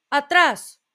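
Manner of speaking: police chief ordering the bear back; commanding shout. A narrator's reading. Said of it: "exclamó el jefe". (grito de mando)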